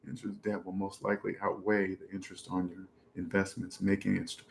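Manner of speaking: low, very soft reading to oneself; comment read-out. Real, not acted. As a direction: read it under your breath, low. Reads out viewer's comment.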